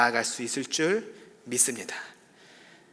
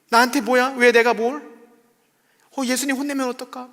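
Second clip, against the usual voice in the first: much higher and louder, a wronged host venting; indignant rant; 9 semitones higher, 8 dB louder than usual. Retold or imitated. Homeowner fumes at guests after cleaning for Jesus, loud indignant rant. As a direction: rant like a servant whose hard work went unnoticed. Homeowner's imagined angry complaint.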